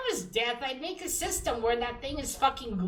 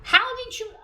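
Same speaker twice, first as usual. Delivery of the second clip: incredulous; 13 semitones higher, 6 dB louder than usual. Retold or imitated. Baffled at comic plot hole.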